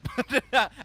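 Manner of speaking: bursting out laughing; big amused laugh; loud laugh. Real, not acted. Laughing at own joke.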